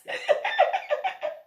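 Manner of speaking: tickled by your own story; real laugh. Real, not acted. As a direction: burst out laughing.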